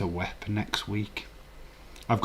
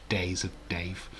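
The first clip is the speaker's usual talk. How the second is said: whispered aside; 2 semitones lower, 6 dB quieter than usual. Real, not acted. Trailing whisper.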